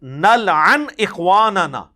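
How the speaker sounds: Arabic words read out very slowly; slow exact wording. Shown as a demonstration. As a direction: lay out "نلعن اخواننا" word by word, slowly. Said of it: "الفاظ ہیں". (الفاظ آہستہ آہستہ، ٹھہر کر)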